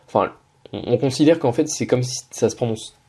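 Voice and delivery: normal talking voice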